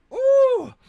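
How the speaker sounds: very high, loud falsetto "ooh"; long, piercing ooh of glee; scandalized ooh. Real, not acted. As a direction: whoop at a savage burn. Reacting to the burn.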